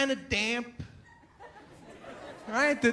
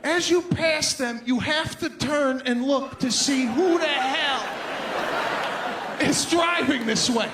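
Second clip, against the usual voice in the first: louder, higher laughing rant; 4 semitones higher, 4 dB louder than usual. Retold or imitated. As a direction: build it up as you pass. Building rant about other driver.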